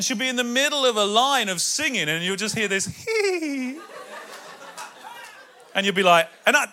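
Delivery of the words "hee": tiny high giggle; a little angelic hee of a laugh. Retold or imitated. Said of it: "Nikki's version, little beautiful angelic giggle".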